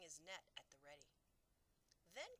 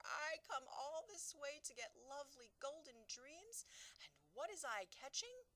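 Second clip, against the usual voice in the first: higher, louder distressed complaint; 11 semitones higher, 7 dB louder than usual. Acted. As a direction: complain bitterly. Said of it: "more distressed every moment".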